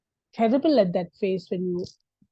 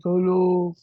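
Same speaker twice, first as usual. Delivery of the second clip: mocking ex-husband; 4 semitones lower than usual. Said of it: "he talks like this".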